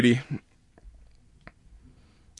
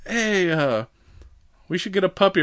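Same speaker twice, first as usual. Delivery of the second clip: lightly voiced Russian spy, pitched higher; a hint of a spy making casual small talk, higher voice; 8 semitones higher than usual. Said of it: "Russian observer, who's definitely a spy".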